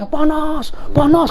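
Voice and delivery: high, pained voice of the possessed woman; possessed woman cries out under the ayat kursi, high and pained; mock possessed cry.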